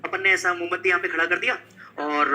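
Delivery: ordinary delivery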